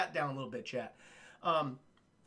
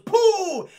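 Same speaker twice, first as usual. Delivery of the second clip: loud high pooh sound effect; mock raft pop; 12 semitones higher, 12 dB louder than usual. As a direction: burst out a popping noise. Raft popping noise imitated.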